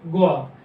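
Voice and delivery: ग्वा said as one gliding syllable, gwa, firm and falling; compound consonant gwa. Shown as a demonstration. Teacher quizzing the class, firmly saying ग्वा. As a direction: blend g and w into ग्वा, short and falling. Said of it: "जी और डब्ल्यू से बनके क्या मिला है?".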